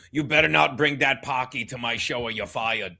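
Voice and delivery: a hint of Stuttering John threatening to fire someone; Stuttering John impression. Host lightly imitating Stuttering John warning a guest off his show. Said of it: "Maybe I do a Stuttering John".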